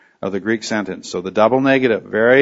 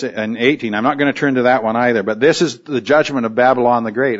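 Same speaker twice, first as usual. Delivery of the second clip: normal talking voice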